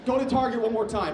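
usual speaking voice

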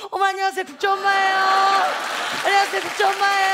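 고상한 인사. Retold or imitated. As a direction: sing out the courteous hello like a proud mother. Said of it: "사람들 지나가면". (지나가는 사람들에게 엄마가 높고 길게 인사하듯)

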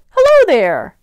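high, very loud greeting; friendly hello. A narrator's reading. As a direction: call out an eager, friendly hello. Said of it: "Trying to be friendly".

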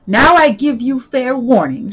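loud, high, slow imperious shout; shouted warning. A narrator's reading. Queen warning the Duchess, shouting. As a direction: thunder it out as the Queen. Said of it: "shouted the Queen".

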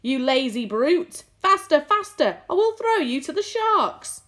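high, loud, driving shouted orders; frantic shouting. A narrator's reading. Centipede exhorting the spinners from below, frantic shouting. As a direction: shout it frantically, pitched well up.

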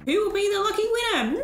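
high, showy prize announcement; grand robot benefactor. Acted. Robot offering villagers a free tour, showy. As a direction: build up to the prize like a host.

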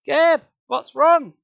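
loud, high, drawn-out demand. A narrator's reading. Sari to boy searching his pockets, demanding. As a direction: press for an answer, alarmed. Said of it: "Sari demanded".

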